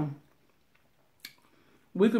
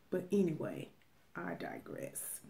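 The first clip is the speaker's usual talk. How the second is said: whispered aside; 3 semitones lower, 16 dB quieter than usual. Real, not acted. Sotto voce aside.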